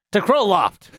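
loud rallying yell. Acted. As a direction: shout it to the whole group. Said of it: "and then yells at everyone".

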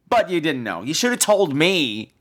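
usual speaking voice

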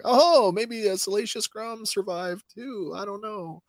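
speculate in a high, put-on voice; mock wondering. Mock excited speculation about a character.

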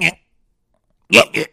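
high, distorted whiny mimicry; mock them in a squawky high put-on voice; exaggerated high-pitched griping caricature; mocking complainers. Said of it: "they're like".

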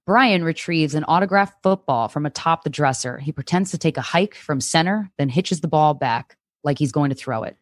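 ordinary delivery

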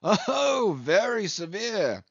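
high, drawn-out mocking echo; mock agreement. A narrator's reading. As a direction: chime in as the teasing patient. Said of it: "chimed in the patient himself".

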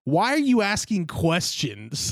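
usual speaking voice

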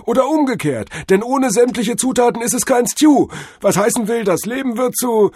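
very high, loud torrent; ecstatic revelation. A narrator's reading. Cordell deduces the meaning of life, high-pitched ecstasy. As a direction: spill out the insight, carried away.

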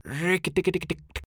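very high chattering cry; mongoose chatter. A narrator's reading.

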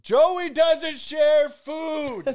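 loud, high, slow drawn-out shout with a laugh; Joey shout. Retold or imitated. Podcast host quotes Joey from Friends, big laughing shout. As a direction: bellow it like Joey guarding his food. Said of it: "where Joey shouts".

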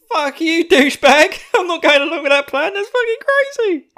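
high, loud, screaming refusal; furious Eren. Acted. Eren screaming at Zeke, refusing his plan, loud and high. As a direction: shriek it at him, outraged. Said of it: "And Eren's just like".

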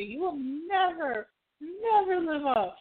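high, slow mock condemnation; scolding matchmaker. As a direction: stretch each never theatrically.

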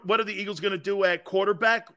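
normal talking voice

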